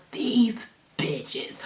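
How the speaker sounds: hissed under the breath; whispered curse. Retold or imitated. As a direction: whisper the insult to yourself. Fuming at friends, muttering.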